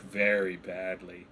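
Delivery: low, soft ominous aside; hushed kobold. Acted. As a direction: drop the voice, grave. Kobolds echo gravely.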